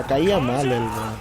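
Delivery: ordinary talk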